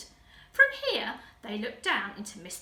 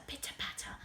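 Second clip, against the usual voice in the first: "pitter-patter" breathed in a soft whisper; whispered echo; 10 dB quieter than usual. A narrator's reading. Reader whispers the pattering footsteps to the children. Narrator echoes footsteps sound.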